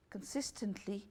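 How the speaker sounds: normal talking voice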